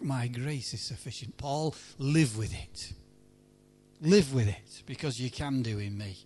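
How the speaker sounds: a hint of a gentle, final answer; low, calm divine answer. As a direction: answer steadily, as God settling it. God's answer, low and slow.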